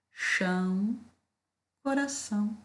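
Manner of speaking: "Chão" and "coração" drawn out to mark the rhyme; rhyme ends stressed. Shown as a demonstration. Teacher pointing out the rhyming endings. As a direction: lean on "Chão" then "coração" rising high. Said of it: "é importante que a gente tenha a entonação".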